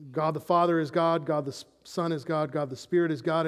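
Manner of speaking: ordinary talk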